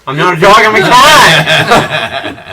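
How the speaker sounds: loud, drawn-out laughing protest; indignant shout. Pretend.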